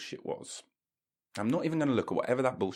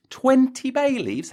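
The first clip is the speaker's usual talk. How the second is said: Twenty bay leaves? much higher, louder and slower; outraged disbelief; 7 dB louder than usual. Acted. Repeating the listener's claim, he shouts high in shocked disbelief.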